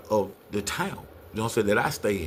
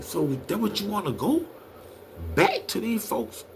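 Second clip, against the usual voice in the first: incredulous; 12 semitones higher than usual. Retold or imitated. Incredulous uncle questioning nephew.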